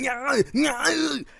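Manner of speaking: high, strained "nyah" wail; comic impression of Arnold screaming his head off; drawn-out high-pitched choking cries; imitated Arnold screaming. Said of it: "it's just Arnold screaming his head off".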